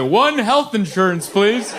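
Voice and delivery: clueless caller. Retold or imitated. Husband phones to buy health insurance, cluelessly naive. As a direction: order it slowly like an innocent idiot. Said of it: "I called like an idiot".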